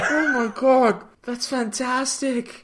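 high, savoring each word; thrilled, high-pitched marvelling; delighted amazement. Real, not acted. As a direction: relish the gossip, slowing at the end. Delighted by the anecdote.

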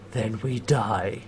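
put-on slow, heavy drunken resignation; drunk Dargo. Host voicing drunk Dargo telling John, slow and heavy. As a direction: drag it out like a hopeless drunk. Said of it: "you know, he's drunk".

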